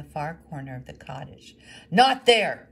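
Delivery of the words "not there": much louder, higher, sudden roar; bellowing. Acted. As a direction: bellow to stop her. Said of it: "bellowed the woodcarver".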